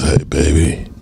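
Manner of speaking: low, seductive crooner pickup. Acted. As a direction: sweet-talk a partner in a deep, unhurried voice. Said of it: "with that voice".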